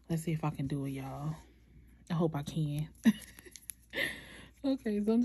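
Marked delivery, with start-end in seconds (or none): 2.1-2.9 hopeful little whisper to herself. Real, not acted. Nervously hoping aloud before the first try, whispered. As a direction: say it under your breath, half afraid to jinx it.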